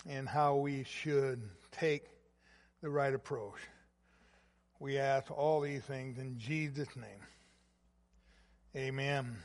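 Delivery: soft, low prayer voice, pulling inward; hushed prayer. Acted. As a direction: pray quietly, bowing the head in the words.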